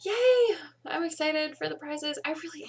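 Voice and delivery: a bright yay that sounds a bit put on; excited cheer. Real, not acted. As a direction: force the enthusiasm up high. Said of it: "I seem inauthentic in my enthusiasm".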